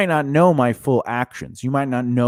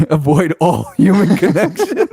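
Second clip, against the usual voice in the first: laughing mockery; 6 semitones higher, 6 dB louder than usual. Mocking partner's avoidant attitude.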